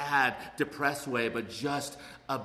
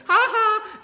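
high, loud jeering "ha ha"; a quick mocking cackle at someone; mean mock laugh; 11 dB louder than usual. Mocking a stranger with a nasty laugh. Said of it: "mean-spirited laughing at someone else's expense".

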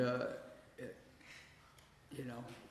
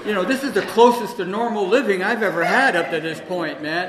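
laughing; 4 semitones higher, 11 dB louder than usual. Real, not acted. Laughing through the punchline.

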